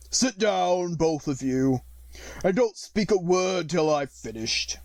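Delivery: slow, hollow, mournful voice; deep hollow tone. Acted. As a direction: speak hollow and heavy with sorrow. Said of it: "in a deep, hollow tone".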